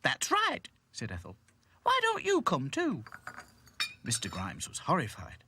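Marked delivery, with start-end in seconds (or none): 0.0-0.7 high, loud character voice for Ethel; Ethel in the story. Acted. 1.8-3.0 high, loud Ethel voice; Ethel inviting. Acted. Ethel invites Mister Grimes.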